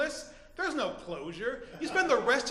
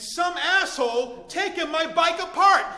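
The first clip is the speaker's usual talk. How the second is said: indignant; 7 semitones higher, 8 dB louder than usual. Retold or imitated. Indignant reliving of bike theft.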